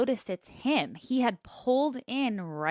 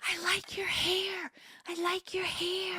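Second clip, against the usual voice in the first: high, breathy creepy whisper; creepy stranger whispering to a woman at her car; 9 semitones higher, 7 dB quieter than usual. Retold or imitated. Creepy man's whispered compliment.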